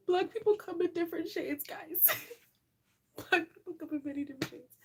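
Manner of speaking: lingering real laughter; still giggling. Real, not acted. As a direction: talk through leftover giggles. Recovering from laughter.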